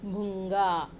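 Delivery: भूंगा loud, with a long nasal ng before गा; ng sound shown. Shown as a demonstration. Teacher showing children the pronunciation, भूंगा loudly sounded out. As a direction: sound out भूंगा firmly, holding ng. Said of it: "ऐसा आया इसका उच्चारण".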